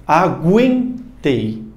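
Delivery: "Aguentei" said slowly with the U sounded as gu-en; slow "Aguentei" with audible u; U pronounced. Shown as a demonstration. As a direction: stretch "Aguentei", sounding the U clearly. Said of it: "perceba que esse U tem pronúncia".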